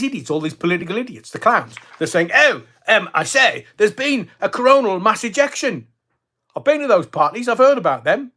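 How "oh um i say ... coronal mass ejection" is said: mock posh politician. Mocking political clowns' claims.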